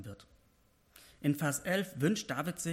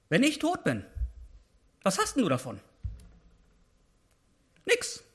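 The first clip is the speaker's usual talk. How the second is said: loud, higher pleading sermon voice, slowing at the end; impassioned preaching; 7 semitones higher, 4 dB louder than usual. Acted. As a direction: preach David's plea at full force. Preaching at full force.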